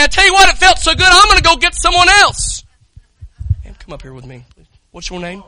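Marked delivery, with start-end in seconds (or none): 0.0-2.6 excited shout. Retold or imitated. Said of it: "And I say".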